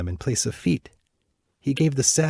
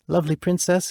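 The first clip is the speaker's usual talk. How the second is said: a hint of a comforting prince, higher; lightly voiced prince; 9 semitones higher than usual. Bird prince comforting the weeping princess, lightly voiced. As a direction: reassure her gently. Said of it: "he replied".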